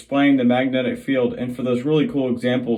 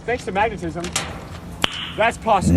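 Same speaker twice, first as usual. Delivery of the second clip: high, loud ad tagline; bright curious science host; 9 semitones higher, 4 dB louder, faster than usual. Acted. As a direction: land the slogan big. Ad punchline with music.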